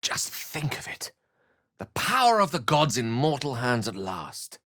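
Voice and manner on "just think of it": breathless hushed whisper; awed whisper. Acted. Dreaming aloud of godly power, in a hushed whisper. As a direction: whisper it like a secret too grand to say aloud.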